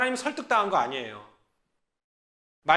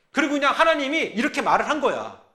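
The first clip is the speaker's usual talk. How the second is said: ordinary talk, nothing marked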